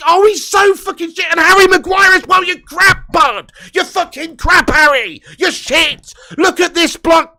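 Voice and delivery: high, loud insults thrown at the screen; angry yelling. Real, not acted. Fan berating Lindelof and Maguire, angry yelling. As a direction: let rip at the two defenders. Fan rants at both defenders.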